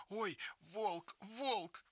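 put-on high frightened squeal of a little girl; panicked child spotting a wolf; frightened cry. Girls see a wolf.

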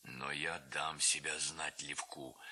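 low, slow threat; menacing low man. A narrator's reading. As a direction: promise trouble, slow and low. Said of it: "продолжал всё так же незнакомец". (незнакомец угрожает низко и медленно)

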